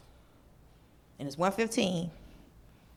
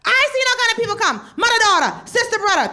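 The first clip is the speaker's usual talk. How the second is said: higher, louder, faster rambling uncle; chatty old driver; 9 semitones higher, 7 dB louder than usual. Retold or imitated. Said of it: "he's like".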